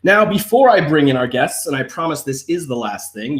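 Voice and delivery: ordinary talk, nothing marked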